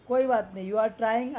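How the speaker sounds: ordinary delivery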